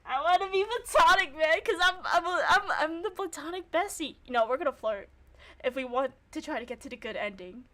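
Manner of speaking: usual speaking voice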